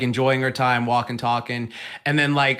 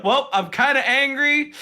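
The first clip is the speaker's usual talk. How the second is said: higher-pitched, mock restrained anger; angry through a smile; 8 semitones higher than usual. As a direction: imitate a friend smiling while annoyed. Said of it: "like, doing that smile".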